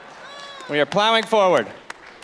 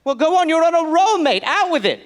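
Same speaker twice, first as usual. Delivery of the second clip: put-on high, urging British accent; British gentleman; 7 semitones higher than usual. Colonist urging his friend on, high British accent. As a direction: egg him on like a keen Englishman.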